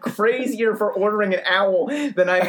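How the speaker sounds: high, laughing protest. Real, not acted. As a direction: laugh through the mock-offended question. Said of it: "laughing protest to friends".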